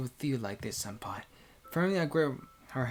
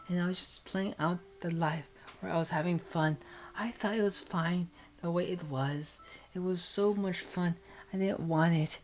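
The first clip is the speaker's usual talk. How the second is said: put-on tearful young woman; 5 semitones higher than usual. Senpai's confession.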